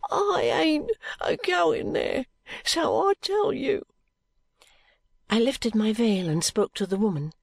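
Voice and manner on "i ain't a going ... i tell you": put-on high, drawn-out voice of a sick boy; slow, high whine of a poor street boy; muttering frightened boy. A narrator's reading. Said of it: "muttered the boy".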